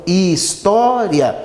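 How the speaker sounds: "história" devagar, com "tó" prolongado; ênfase no TÓ de história. Shown as a demonstration. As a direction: marque o "tó" tônico de "história". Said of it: "O to é uma sílaba tônica". ("história" pausado, destacando a sílaba "tó")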